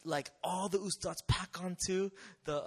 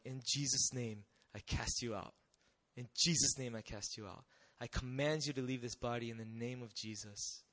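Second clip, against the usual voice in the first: quiet command prayer; 4 semitones lower, 6 dB quieter than usual. Retold or imitated. Casting out a demon.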